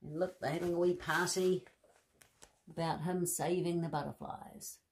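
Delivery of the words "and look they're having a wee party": plain reading voice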